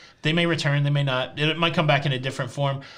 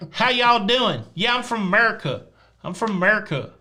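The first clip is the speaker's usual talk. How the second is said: put-on Southern American accent; 4 semitones higher than usual. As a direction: play up the Southern American twang. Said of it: "what kind of accent that was".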